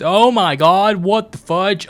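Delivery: high, loud burst of surprise; startled outburst. Real, not acted. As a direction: blurt it out, caught off guard. Startled by game's opening.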